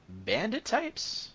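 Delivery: slow question leaping high at the end; questioning constable. Acted. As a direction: stretch it into a high, probing question.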